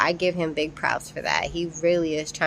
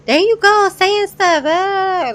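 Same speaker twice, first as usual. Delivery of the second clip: mocking the mom; 12 semitones higher, 7 dB louder, slower than usual. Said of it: "the mom jumps up, like".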